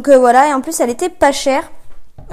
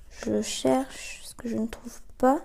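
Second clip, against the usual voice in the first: whisper; 3 semitones lower, 11 dB quieter, slower than usual. Real, not acted. Talks to herself while searching.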